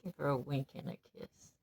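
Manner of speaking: low, hushed and tender; quiet, sweet peace offering; soft loving advice. Acted. Coaching son to disarm sister lovingly.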